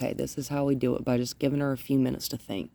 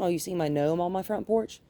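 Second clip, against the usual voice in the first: ordinary talk, nothing marked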